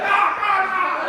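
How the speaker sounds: hammed-up vomiting groans; mock retching cries. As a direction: retch loudly like a man puking. Imitating Kenny vomiting.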